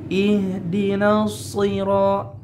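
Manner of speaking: Ihdinas sira with a thick, heavy sod; slow recitation, sod made thick. Shown as a demonstration. Teacher reciting Ihdinas sira with a thick sod. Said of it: "lebih tebal".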